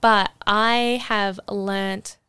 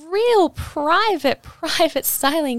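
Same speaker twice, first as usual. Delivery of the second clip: excited emphasis; 7 semitones higher, 5 dB louder, slower than usual. Real, not acted. Stressing a private studio.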